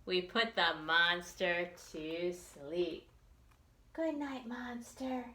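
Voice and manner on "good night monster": ordinary delivery, nothing marked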